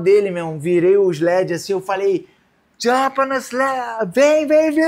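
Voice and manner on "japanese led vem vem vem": calling out higher and louder; mock lifeguard shout, slow at first; retold urgent call. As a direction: urge him to come to the jet ski. Lifeguard calling victim over.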